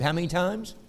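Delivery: ordinary talk, nothing marked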